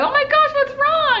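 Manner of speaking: high-pitched, concerned friend; alarmed concern. Retold or imitated. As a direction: imitate a friend suddenly worried for you. Voicing friend noticing the tears.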